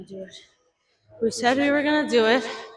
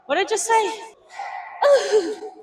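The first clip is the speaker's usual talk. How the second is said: squeaky; 10 semitones higher, 4 dB louder than usual. Real, not acted. Shocked at own high squeal.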